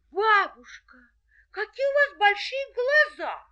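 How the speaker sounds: put-on high, loud amazed girl; wide-eyed little girl. A narrator's reading. Girl asking granny.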